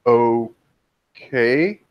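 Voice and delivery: drawn-out, uncertain okay, rising at the end; thrown, hesitant, two slow syllables; momentarily panicked. Acted. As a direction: stretch it into a baffled question. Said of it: "seems to panic for a moment".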